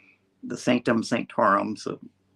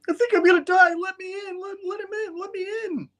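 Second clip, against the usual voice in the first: very high, squealed pleading; mock panic; faster than usual. Spidey begs while imps attack.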